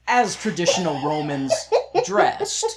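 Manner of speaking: laughing through the words; high giggly burst of laughter. Real, not acted. Mocking silly Roman costumes.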